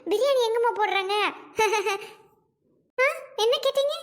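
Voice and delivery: high sugary coaxing voice; sweet coaxing. Acted. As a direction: sweet-talk a little girl for information. Mother coaxes a child for biryani.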